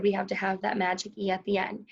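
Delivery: ordinary talk, nothing marked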